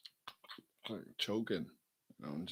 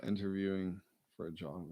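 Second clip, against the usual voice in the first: normal talking voice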